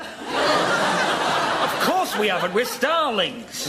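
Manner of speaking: slow, high, huffy indignation; father bird, slow and scandalised; indignant. Acted. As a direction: drag it out, offended. Indignant starling parent.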